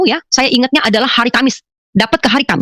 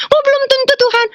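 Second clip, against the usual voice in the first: dismayed echo. Retold or imitated. Woman repeating God's answer back to him, high dismayed echo. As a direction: wail it back high, like a let-down child. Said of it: "Saya bilang".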